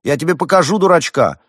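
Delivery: high, loud, offended retort; outraged shout at an insult; indignant threat. A narrator's reading. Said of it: "возмутился Эдуард".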